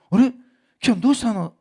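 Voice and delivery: put-on teacher noticing a child who didn't raise his hand; surprised teacher. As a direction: ask like a teacher caught off guard. Teacher asks silent child.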